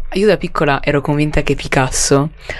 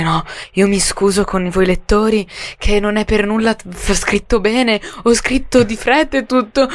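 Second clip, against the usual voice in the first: higher, hammed-up humble plea to readers; mock-apologetic Petrarch; 6 semitones higher than usual. Student imitating Petrarch apologizing to his readers, mock-apologetic. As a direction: lightly mimic a poet excusing himself. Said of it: "all'inizio delle sue canzoni".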